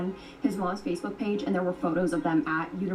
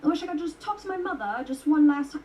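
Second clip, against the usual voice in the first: a weak, higher try at an Australian accent; bad Australian accent; 6 semitones higher than usual. Shown as a demonstration. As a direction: fumble through an Australian accent, half-hearted. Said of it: "I can't even do an Australian accent".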